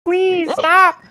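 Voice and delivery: high, loud goblin plea; frantic little creatures pleading at the top of their pitch; panicking goblins. Acted. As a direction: beg in a squealing goblin panic. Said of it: "the goblins look to Marjorie and they're like".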